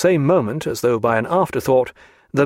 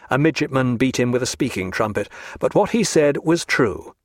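plain reading voice

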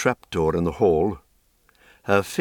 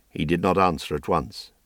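usual speaking voice

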